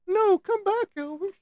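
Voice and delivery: high mock call after someone leaving. Streamer joking that Elvis left, calls him back high and playful. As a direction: cry out playfully for Elvis to return.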